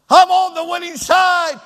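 the right way to answer "How you doing?"; triumphant shout. Shown as a demonstration. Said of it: "Have your voice trained to say".

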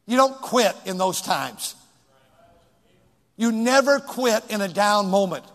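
loud, high preaching at full force; fiery preacher driving it home to the congregation; preaching hard. Acted. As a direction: hammer the point home from the pulpit. Opening exhortation at full force.

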